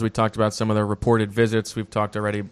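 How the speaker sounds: ordinary talk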